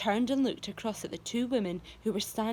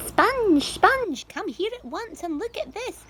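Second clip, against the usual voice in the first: excited calling; 11 semitones higher, 9 dB louder than usual. A narrator's reading. Spiker calls her sister.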